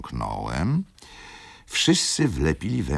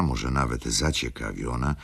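ordinary delivery, nothing marked

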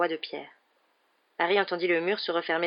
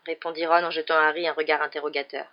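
ordinary delivery

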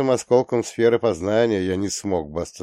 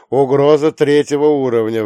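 loud, higher emergency announcement; urgent alert; 5 semitones higher, 8 dB louder than usual. Acted. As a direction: ring out the threat level. Dispatcher repeats threat level.

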